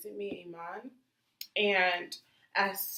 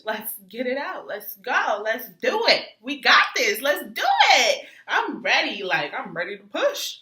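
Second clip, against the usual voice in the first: loud, pumped-up rallying cry; hyped-up cheer; 4 semitones higher, 15 dB louder than usual. Retold or imitated. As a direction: psych yourself up, full volume. Pumping herself up to push.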